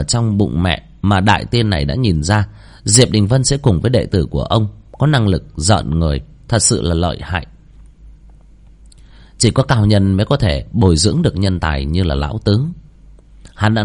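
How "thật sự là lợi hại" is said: ordinary delivery, nothing marked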